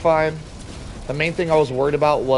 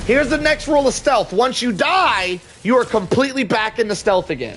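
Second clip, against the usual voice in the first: excited; 8 semitones higher than usual. Real, not acted. Joking rule after dying.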